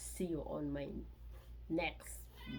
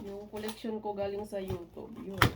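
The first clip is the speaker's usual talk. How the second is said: ordinary talk, nothing marked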